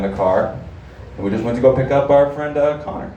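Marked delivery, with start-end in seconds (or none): none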